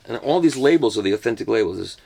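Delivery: normal talking voice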